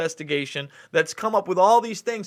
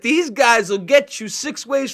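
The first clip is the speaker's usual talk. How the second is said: mafia captain; 5 semitones higher, 4 dB louder than usual. Retold or imitated. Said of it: "like a mafia captain".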